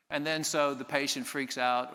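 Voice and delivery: usual speaking voice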